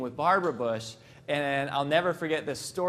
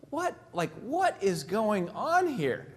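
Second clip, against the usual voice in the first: much higher, then slow puzzled question; baffled George; 4 semitones higher, slower than usual. Retold or imitated. George in the limo watching his wife hug a stranger, baffled. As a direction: play the confused husband peering out. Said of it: "George is sitting in the limo going".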